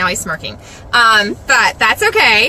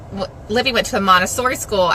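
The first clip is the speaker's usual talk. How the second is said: ordinary delivery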